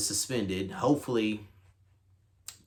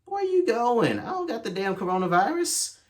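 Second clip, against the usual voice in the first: incredulous callout to the woman walking away; indignant retort; 12 semitones higher than usual. Retold or imitated. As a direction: throw it at her like she's being ridiculous. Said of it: "I'm just looking at her like".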